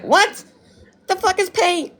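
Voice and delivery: high-pitched incredulous reply; mock bafflement. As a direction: play a baffled stranger, voice way up. Imagined stranger's baffled reply.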